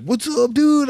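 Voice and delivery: high bro greeting; hyped-up high greeting to a friend. Retold or imitated. Fans greeting the comic after a set, high and hyped. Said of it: "They'll be like".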